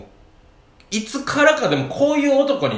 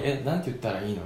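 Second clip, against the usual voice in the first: shy whisper; 5 semitones lower, 9 dB quieter than usual. Retold or imitated. Retold shy boy whispering to dad.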